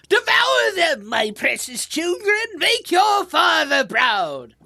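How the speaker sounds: high, loud villain shout; distant villain war cry. Acted. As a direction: bellow to your brood like a monstrous father in battle. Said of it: "distinct voice in the distance saying things like".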